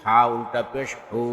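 final "হু" held long; drawn-out vowel. Shown as a demonstration. Teacher demonstrating ulta pesh, long "হু". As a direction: stretch the last "হু" for one alif. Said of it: "একালিপ টেনে পড়তে".

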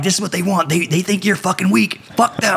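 high-pitched rallying cry, climbing as it goes; retold yelling. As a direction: imitate rousing the troops, pitch rising. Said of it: "I'm yelling at the other students".

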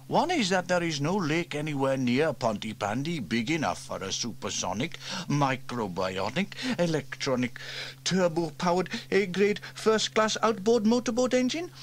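put-on boy teasing his uncle's boast; mocking repeat by James. A narrator's reading. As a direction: parrot his boast back, climbing. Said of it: "said James".

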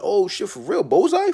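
very high, loud squeal of disbelief; shocked disbelief. Retold or imitated. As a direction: squeal it like you can't believe it. Disbelief at winning.

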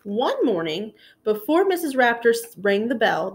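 usual speaking voice

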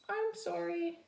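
high, little apologetic chirp; small voice. Acted. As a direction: apologise like a tiny bird. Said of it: "he said in a small voice".

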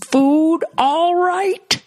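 very high, drawn-out movie impression; Clockwork Orange character. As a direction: stretch it out in a strained high yell. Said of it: "that guy in Clockwork Orange".

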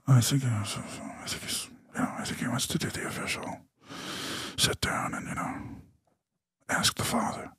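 whispered, hesitant confiding; acted-out whispered phone aside; hushed phone voice. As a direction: whisper it haltingly, as if passing on a secret. Voices wife's hushed phone call.

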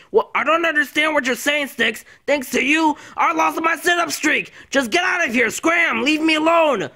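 high, loud irritated outburst; angry dismissal. Acted. Friend blaming Sticks for lost streak, angry. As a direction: shoo them off, loud and high-pitched. Angrily sends Sticks away.

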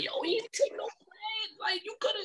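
high, breathy whisper of amazement; a stunned thought breathed out; whispered inner thought. Retold or imitated. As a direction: whisper it in awe, barely voiced. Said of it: "I was like".

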